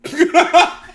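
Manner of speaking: high, loud spluttered chuckle; mimicking someone trying not to crack up; imitated chuckle. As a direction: burst out a short stifled laugh. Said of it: "He just chuckles".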